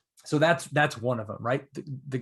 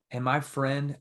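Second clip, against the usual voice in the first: usual speaking voice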